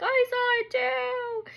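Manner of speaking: high, drawn-out wail; mock crying child. Daughter backing up mom about the bug, mock crying. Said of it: "my daughter's crying".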